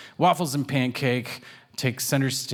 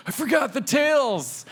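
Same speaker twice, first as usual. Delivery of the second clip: high, sudden alarmed cry; mock panic; 13 semitones higher than usual. Artist realizing she forgot to draw tails, high mock panic. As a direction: yelp it like you just remembered. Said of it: "I'll be like".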